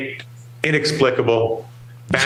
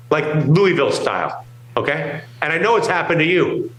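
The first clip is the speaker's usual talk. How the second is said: normal talking voice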